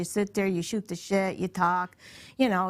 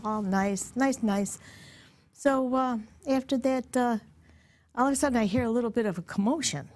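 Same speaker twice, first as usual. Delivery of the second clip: ordinary talk, nothing marked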